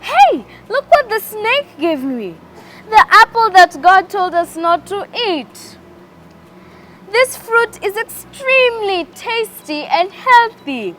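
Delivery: high excited calling out; excited shout. Acted. Woman runs to the man, calling out high and excited. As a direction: call out high and thrilled about the apple. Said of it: "and she shouted".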